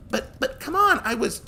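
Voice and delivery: put-on very high, loud flustered girl; bewildered. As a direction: stammer in confusion. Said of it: "positively bewildered".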